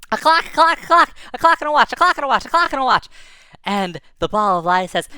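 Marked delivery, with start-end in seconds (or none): 0.1-3.1 loud, high repeated answer; excited shout. Retold or imitated. Boy shouting the answer at the ball of light. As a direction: blurt it out triumphantly.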